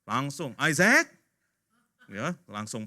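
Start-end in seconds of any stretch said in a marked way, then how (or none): 0.6-1.1 loud, raised-pitch summons; stern call. Acted. Papa summoning his son at home, stern. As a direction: call the boy out sharply.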